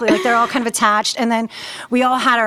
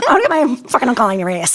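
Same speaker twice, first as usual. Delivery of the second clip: fast, raised mock threat in New York accent; threatening New York accent; 4 semitones higher, 4 dB louder, much faster than usual. Student warning classmates about her uncle, tough New York accent. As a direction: threaten them in thick New York, half-joking. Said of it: "I can hear you talk like that".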